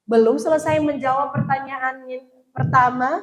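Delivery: ordinary talk, nothing marked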